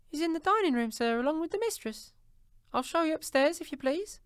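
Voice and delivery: put-on very high girlish voice; high-pitched, deferential maid; polite servant girl. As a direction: pipe up as an obliging housemaid.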